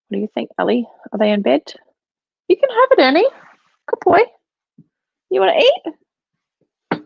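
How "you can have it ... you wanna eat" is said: very high, louder cooing praise; doggy talk. Real, not acted. Owner praising Ernie as he eats, high cooing. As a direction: gush up high at the dog.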